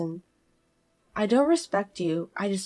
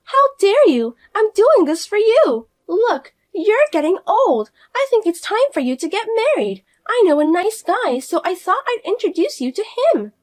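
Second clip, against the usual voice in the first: high, loud sudden outburst; shrill snapping sister-in-law; 12 semitones higher, 8 dB louder than usual. Acted. Fiona snapping at her sister-in-law, high and loud. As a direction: cut her off sharply.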